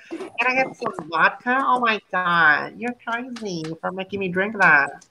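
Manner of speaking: put-on pampered New York rich girl in a Valley Girl accent. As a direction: tease like a spoiled Valley Girl. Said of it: "anak senopatinya New York".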